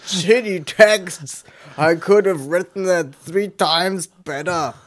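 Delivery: put-on loud, high, slow drunken English bellow; drunk British rant. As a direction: bellow like a drunk Brit in the corner. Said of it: "brüllst du wie so ein ... Cis-Mann besoffen".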